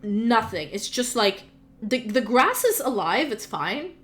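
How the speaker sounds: ordinary delivery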